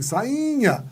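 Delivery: sainha with a long, high I; stressed tonic vowel. Shown as a demonstration. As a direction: stretch and lift the "i" in sainha. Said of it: "Ela é tônica?".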